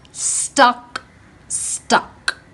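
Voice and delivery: usual speaking voice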